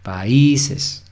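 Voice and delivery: "Países" as pa-Í-ses, "í" stressed. Shown as a demonstration. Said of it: "si no diríamos países". (acento en la "í" de "Países")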